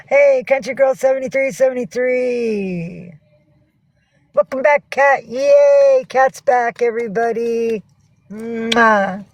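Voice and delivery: high, loud, delighted welcome to a friend; excited greeting. Real, not acted. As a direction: call out happily to the viewer coming back. Greets a returning chat friend.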